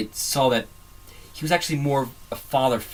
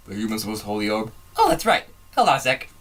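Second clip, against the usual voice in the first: Papyrus voice; 2 semitones lower, faster than usual. Retold or imitated. Acting out Papyrus's plan failing.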